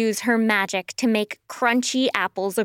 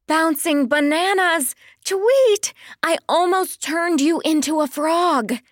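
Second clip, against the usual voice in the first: high-pitched, exasperated exclamation; startled scolding; 5 semitones higher than usual. Acted. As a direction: scold a friend after a scare. Pearl startled by her friend's trick.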